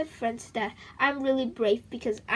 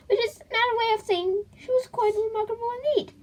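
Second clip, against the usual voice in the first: mock crying; 10 semitones higher, faster than usual. Mock-emotional aside.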